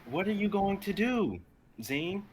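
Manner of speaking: high, strange voice asking gently. Acted. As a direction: ask him where he'll go.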